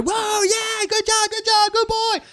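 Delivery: very high, gushing praise voice; excited dog praise. Retold or imitated. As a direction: cheer on the dog in a squeaky voice. Praising dog after retrieving a bird.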